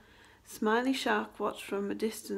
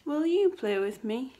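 asking to play; 3 semitones higher, 5 dB louder than usual. A narrator's reading. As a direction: ask it as a hopeful request to play. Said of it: "he asked Angelfish".

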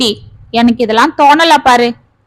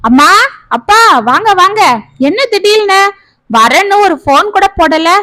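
surprised welcome; 7 semitones higher than usual. Acted. Daughter surprised by parents' arrival.

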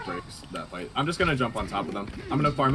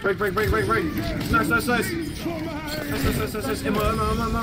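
excited callouts; 9 semitones higher than usual. Real, not acted. Tense teamfight callouts.

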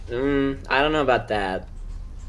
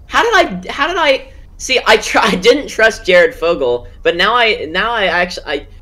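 much higher, louder incredulous laughing rant; laughing disbelief; 8 semitones higher, 6 dB louder than usual. Real, not acted. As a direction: crack up at the absurd twist.

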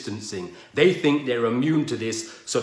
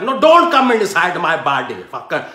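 mock outrage, louder and higher; angry Indian guy; 6 semitones higher, 8 dB louder than usual. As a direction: shout him away from your body. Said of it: "the Indian guy's like".

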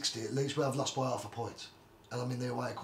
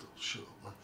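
dismissive mutter; 12 dB quieter than usual. Retold or imitated. Dismissing a suspected wind-up call.

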